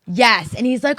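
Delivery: normal talking voice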